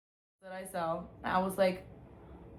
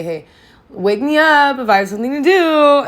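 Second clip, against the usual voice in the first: loud, high, urgent plea; a loud, pitched-up prayer to God; raised plea to God; 7 semitones higher, 12 dB louder than usual. Retold or imitated. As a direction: call up to God, asking to be woken. Quoting her prayer to God.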